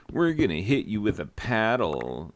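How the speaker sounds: lightly put-on frat brother threatening a pledge; a hint of a rowdy frat boy; mock frat-boy threat.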